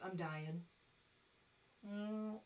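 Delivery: ordinary talk, nothing marked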